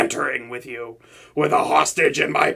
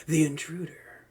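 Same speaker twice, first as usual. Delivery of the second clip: hushed villain whisper, barely voiced; sneaky whisper; 14 dB quieter than usual. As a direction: whisper it, creeping up on someone.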